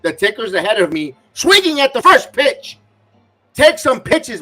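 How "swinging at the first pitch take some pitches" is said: higher, louder exasperated complaint; frustrated outburst. Real, not acted. Fan fuming at the batter swinging early, frustrated. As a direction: vent at the batter, pitch rising.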